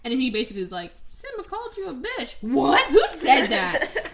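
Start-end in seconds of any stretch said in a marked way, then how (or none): 1.1-2.3 high, soft sly tattle; a lightly voiced sly tattletale; sneaky Scar. Scar tricking the water buffalo, in a high sly hush. 2.4-4.1 loud, slow mock bellow; hammed-up offended roar, laughing; outraged buffalo. The water buffalo hearing the insult, with a loud slow mock bellow.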